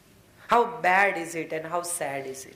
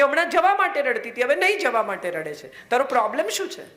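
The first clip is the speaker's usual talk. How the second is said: exasperated; 6 semitones higher than usual. Acted. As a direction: throw up your hands at her. Kabir exasperated at Lali.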